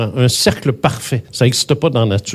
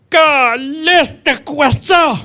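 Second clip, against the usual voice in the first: much higher, shouted in astonishment; retold startled yell; 13 semitones higher, much slower than usual. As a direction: yell it out, stunned by what he sees. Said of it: "Tu sais, il hurlait". (cri d'étonnement rapporté, beaucoup plus aigu)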